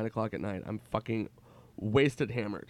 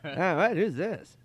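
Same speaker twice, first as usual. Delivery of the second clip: Norm impression; 7 semitones higher, slower than usual. Norm greeting his buddy Nicky on the phone, hammed-up. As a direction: gush like an old pal who just remembered.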